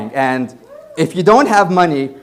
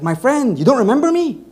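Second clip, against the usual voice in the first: high, pushy mock hustler voice; insistent hustler; 9 semitones higher, 3 dB louder than usual. Insistent tout stopping the tourist, high and pushy. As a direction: press him like an insistent tout. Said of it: "He's like".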